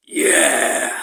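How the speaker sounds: loud, low harsh yell; scream. Shown as a demonstration. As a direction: belt a low harsh yeah. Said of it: "nos premiers screams".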